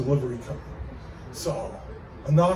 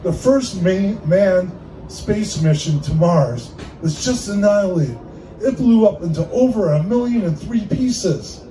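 higher, punchy news anchor voice; mock news read; 3 semitones higher, 3 dB louder than usual. Comedian spoofing a news anchor for the room, dramatic announcer read.

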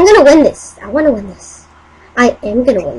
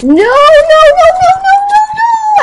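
shrieking no, no, no, far higher and louder; panicked scream; 4 dB louder than usual. Real, not acted. Gamer dying in the match, panicked high screams. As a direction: scream in panic as you die. About to die in game.

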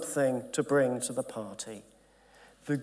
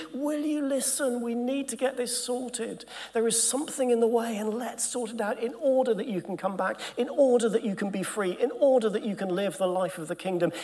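kindly Holy Spirit; 8 semitones higher than usual. Acted. The Holy Spirit gently pleading with a straying believer, kind and higher. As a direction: speak like a patient loving guide calling someone back. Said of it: "he says kindly".

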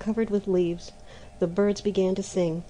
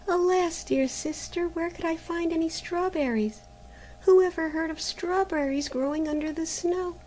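put-on pleading girl; 9 semitones higher than usual. A narrator's reading. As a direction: plead gently, bewildered. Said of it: "said Maruschka".

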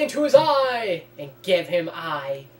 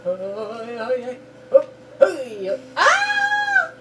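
loud high yell then slow boast; hammy hero shout; 6 semitones higher, 4 dB louder, much slower than usual. Acted. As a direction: yell it out, then savor lusciousness. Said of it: "I just love doing voicing".

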